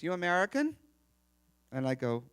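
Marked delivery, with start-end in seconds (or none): 0.0-0.8 higher, louder imitated question; little Greek lady. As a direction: quiz a stranger as the little lady. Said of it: "And she goes".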